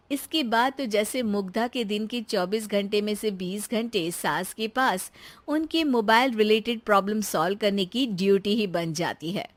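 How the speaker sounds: ordinary delivery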